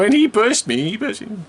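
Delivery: put-on high, pleading kid voice defending himself; mock high-pitched kid excuse; childish pleading. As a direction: plead like a scared little boy caught out.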